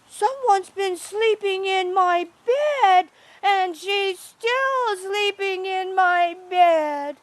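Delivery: put-on high, loud baby bear wail; wee baby bear. A narrator's reading. Baby bear finding someone in his bed, high loud little voice. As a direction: squeal it out like an upset little bear cub. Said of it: "wee little baby bear".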